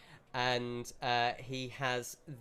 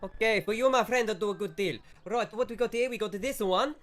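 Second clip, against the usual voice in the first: louder, higher, fast mock Italian shopkeeper; shopkeeper accent; 8 semitones higher, 5 dB louder than usual. Shopkeeper brushes off customer, louder fast mock Italian accent.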